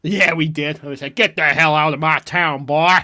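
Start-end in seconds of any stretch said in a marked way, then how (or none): 1.2-3.0 much louder hammed-up showdown shout; mock cowboy threat. Staff throw the kid out of town, loud mock cowboy shout. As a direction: shout him out of town like a western sheriff. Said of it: "Like that".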